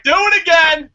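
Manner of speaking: hammed-up shouted command; mock boss shout. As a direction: yell it like a demanding boss. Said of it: "And Vince is like".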